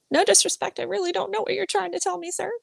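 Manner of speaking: high-pitched, deferential mock plea; polite apologetic plea. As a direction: act out a quick, high, careful apology. Acted polite plea to pub owner.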